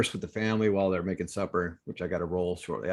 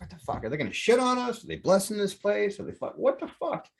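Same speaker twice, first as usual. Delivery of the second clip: much higher, bewildered questions, last one breathed; baffled amazement; 10 semitones higher than usual. Retold or imitated. Retelling his reaction to the crows, high baffled questions. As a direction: marvel at the crows, half disbelieving. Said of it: "I was like".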